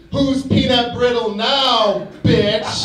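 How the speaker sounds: slow, high, loud peanut scream; mock scream. Mister Peanut screaming at his victim, hammed-up. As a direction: scream it with years of pent-up rage. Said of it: "Mister Peanut will scream".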